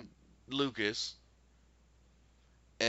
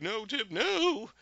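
higher, slow drawn-out mild plea; Dorothy begging Tip not to walk away, mildly pleading; 12 semitones higher, slower than usual. Retold or imitated.

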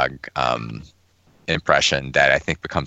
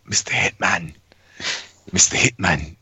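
British Bulldog impression; 2 semitones higher than usual. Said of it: "'cause the impression".

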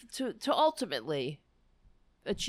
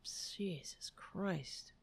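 exasperated whisper; 12 dB quieter, much slower than usual. Real, not acted. Exasperated aside after political rant.

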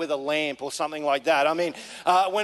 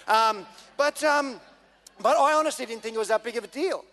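ordinary delivery

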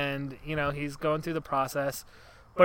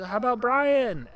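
high, slow, loud called-out question; mock lover's call; 8 semitones higher, 5 dB louder than usual. Lover shouting a name to Pontius, high slow call. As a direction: call the name out slowly from the crowd. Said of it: "comes and she says".

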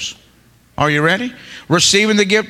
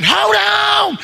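high, drawn-out altar yell; mock scream; 13 semitones higher than usual. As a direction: holler it up high like a church screamer. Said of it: "somebody's screaming".

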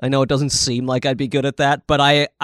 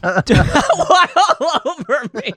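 giggling through the words; barely holding back laughter; laughing; 13 semitones higher, much slower than usual. Real, not acted. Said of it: "I'm in a giggly mood".

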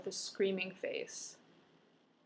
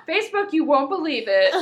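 higher, louder, giggly pretend post; excited mock announcement; 5 semitones higher, 12 dB louder than usual. Telling Facebook about seeing a ghost, excited mock announcement. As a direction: blurt it out like big news. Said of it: "go on Facebook and be like".

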